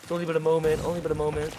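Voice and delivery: squeaky put-on coaxing voice; high-pitched mock pleading. Player coaxing a reluctant teammate to help, squeaky pleading. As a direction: coax in a high, silly little voice.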